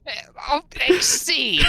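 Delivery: high, loud disbelief; can't believe what they heard; incredulous. Real, not acted. Incredulous at friend's claim.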